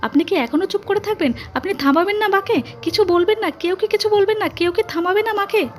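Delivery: very high, agitated pleading; desperate appeal. A narrator's reading. Wife begging husband to stop his mother, desperate. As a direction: implore them all in a high, urgent voice.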